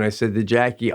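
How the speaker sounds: ordinary talk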